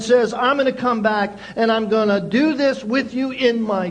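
loud, high, driving preacher voice; full-force preaching. Acted. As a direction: proclaim it to the whole church, full power.

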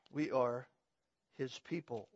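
usual speaking voice

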